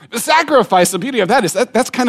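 high, loud emphasis on sacrifice; proclaimed. Acted. Preacher stresses sacrifice to the congregation, loud. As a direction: ring the word out.

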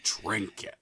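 breathy whisper of one word. Acted. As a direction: whisper it like a secret.

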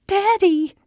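put-on very high, pleading little-kid call; frightened child's cry. A narrator's reading. Scared child starting to cry to dad, high wail. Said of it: "Steph started to cry".

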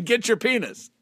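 hawker call misheard as penis; stadium vendor hawking. Retold or imitated. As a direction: yell it like a ballpark vendor. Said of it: "people are not yelling".

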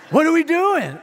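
put-on high, loud, eager kid voice; excited little girl. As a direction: play the fired-up seven-year-old. Seven-year-old daughter bursts in.

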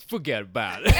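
put-on a thick "fuhgeddaboudit" New York Italian accent. Jason brushing off the pizza guy, New York Italian accent. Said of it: "he just goes".

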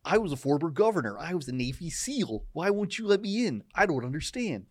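indignant whine, pitch jumping up; Jesse Ventura voice. Retold or imitated. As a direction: complain like a locked-out ex-governor. Mocking Jesse Ventura again.

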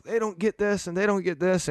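retold venting, pitched well up; exasperated, insistent griping at no extra volume; frustrated complaint. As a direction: replay a high-pitched, exasperated gripe. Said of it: "I was just frustrated".

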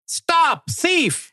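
high-pitched cartoon cry of stop thief; high shout. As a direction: yell it high like a cartoon cop. Cop shouting at thief in comic.

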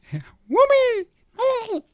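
very high squealed squid cry; Splatoon squid voice. Retold or imitated. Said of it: "Splatoon squid impression".

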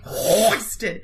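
"Hoisted" said low and drawn out for comic relish; hoisted on request. Shown as a demonstration. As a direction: savour the word "Hoisted" low. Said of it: "You say hoisted one more time".

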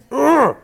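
loud, high "ugh" of disgust; disgusted groan. Real, not acted. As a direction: groan in exasperation. Disgust at a TV show.

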